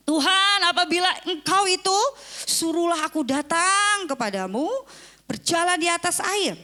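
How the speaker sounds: high, loud call across water; Peter calling out. Retold or imitated. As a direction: shout to Jesus from the boat. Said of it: "Petrus ... dengan mengatakan". (Petrus berseru keras kepada Yesus)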